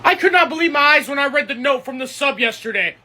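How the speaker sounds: ordinary talk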